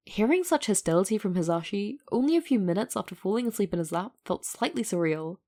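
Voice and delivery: ordinary delivery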